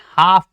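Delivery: हाफ with silent l; right pronunciation. Shown as a demonstration. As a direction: go from long aa straight to f in हाफ. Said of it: "इसको हम क्या पढ़ते हैं". (हाफ, 'ल' चुप रखकर)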